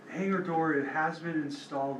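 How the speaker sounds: normal talking voice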